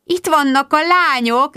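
high, loud, joyful greeting; gleeful high-pitched exclamation; delighted. A narrator's reading. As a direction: call out in delight. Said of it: "örvendezett Magda".

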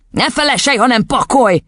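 cross fatherly scolding; snapping angrily. A narrator's reading. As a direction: snap at the child. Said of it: "förmet rá az apja".

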